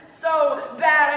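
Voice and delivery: a loud burst of pain on bad; anguished cry. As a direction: let the hurt break out. Poem climax, pain.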